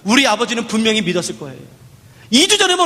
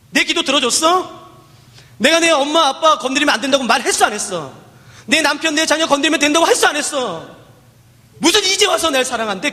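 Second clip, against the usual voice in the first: higher, accusing, bitter questions; resentful believer's complaint; 5 semitones higher than usual. Pretend. A believer scolds God for unanswered prayers, higher and bitter. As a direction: throw the grievances back at God.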